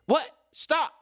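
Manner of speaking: high, sudden mock yell; alarmed cry of stop; startled yell. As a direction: shout it as the scuffle breaks out. Scuffle heard behind the fence.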